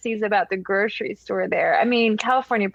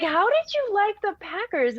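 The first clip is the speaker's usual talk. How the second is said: surprised question; 11 semitones higher than usual. Retold or imitated. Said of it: "I'm just like".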